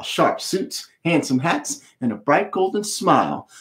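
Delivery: usual speaking voice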